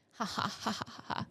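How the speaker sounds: soft, airy mock laughter; imitated laughing. Dead wife in a flashback, softly faked laughing. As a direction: fake a faraway dreamy laugh. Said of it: "she's just laughing".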